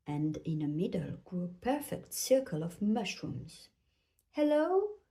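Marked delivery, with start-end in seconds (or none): 4.3-5.0 high, questioning call; lilting, higher-pitched hello. A narrator's reading. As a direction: call into the woods to see who's there. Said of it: "called Tiny Jenny".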